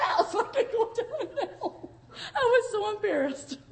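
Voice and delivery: laughing through the words, half crying; laughing embarrassed. Real, not acted. Preacher recalling her embarrassment at the old chapel, laughing. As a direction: giggle it out, shamed but amused.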